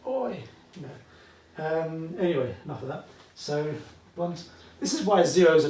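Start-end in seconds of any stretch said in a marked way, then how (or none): 0.1-0.4 high playful British "oi!"; jokey oi. Pretend. Teacher sees zero I and thinks oi, playful high call. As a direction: call out "oi!" like a cheeky mate. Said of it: "I always think that".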